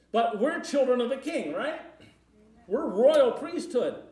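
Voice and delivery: high, loud proclaiming; preacher booming out royal identity to church; preaching at full force. Acted. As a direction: lift it up like a triumphant declaration. Preacher rising to emphatic claim.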